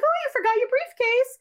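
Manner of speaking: high, airy feigned innocence; innocent act. Retold or imitated. Acting out wife calling to doctor.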